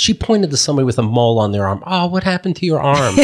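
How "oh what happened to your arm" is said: high, mock-concerned question; mom's concerned question. Mom points at stranger's arm, high imitated concern.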